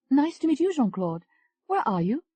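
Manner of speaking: Tony, flirty.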